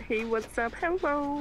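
high pitched frog puppet voice; squeaky Kermit greeting, rising. Pretend. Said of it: "Kermit the Frog?".

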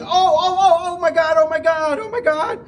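hammed-up screaming pilot in panic; mock panicked scream. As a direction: ham up a pilot's sudden terror. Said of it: "starts screaming".

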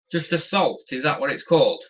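normal talking voice

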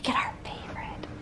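whispered, as if nobody should hear; mock-secret whisper. Letting viewers in on the favorite while someone sleeps, whispered. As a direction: drop to a playful whisper.

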